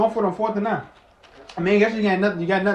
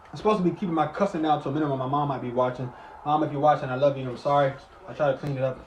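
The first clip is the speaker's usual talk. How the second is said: sincere aside; 7 semitones lower, 7 dB quieter, faster than usual. Real, not acted. Apologizing to mom watching.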